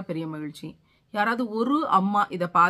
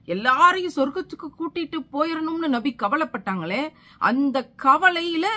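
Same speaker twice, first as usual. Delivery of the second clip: high, loud, anguished appeal; impassioned, raised preacher's voice; fervent preaching; 7 semitones higher, 5 dB louder than usual. Real, not acted. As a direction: pour out the worry from the pulpit. Impassioned preaching of Prophet's worry.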